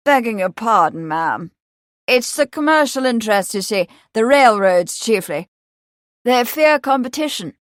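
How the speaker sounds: lightly voiced, loud and emphatic gentleman; a hint of the hearty campaigner; confident young motorist. A narrator's reading. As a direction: lay out the rules loudly. Milbank apologises and explains.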